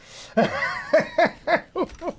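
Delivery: high loud laugh; burst of laughter. Real, not acted. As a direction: let out a big laugh. Bitter laugh about being dismissed.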